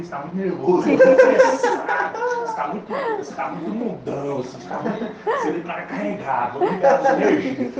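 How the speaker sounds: retelling the teasing through real laughter; laughing. Real, not acted. Monks telling an agitated newcomer to meditate, laughing retelling. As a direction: let the quote break up into giggles.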